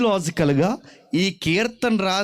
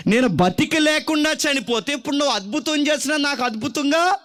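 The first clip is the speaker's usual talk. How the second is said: higher, pressing cry to God; desperate plea; 7 semitones higher than usual. Acted. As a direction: demand an answer before it's too late. Psalmist pleads with the Lord. (ఆవేదనతో దేవుని వేడుకోలు)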